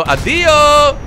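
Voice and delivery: loud, high farewell; triumphant shout. Real, not acted. Player firing at the helicopter, gloating shout.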